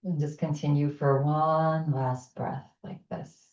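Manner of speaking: pace the listener through each inhale and exhale; guided breathing. Acted. Leads a breathing exercise.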